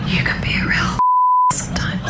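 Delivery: whispering through laughter; laughing whisper. Real, not acted. Mock outrage at teasing.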